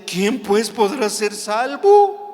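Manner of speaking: astonished disciples. Acted. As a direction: gasp out the question in dismay. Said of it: "se asombraron en gran manera".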